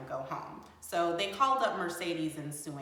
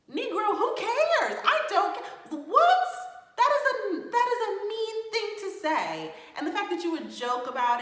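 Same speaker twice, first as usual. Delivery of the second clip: a shrill, loud, laughing burst of indignation; a real outburst at a mean joke; outraged disbelief; 14 semitones higher, 8 dB louder than usual. Real, not acted. Outraged reaction to cast member's joke.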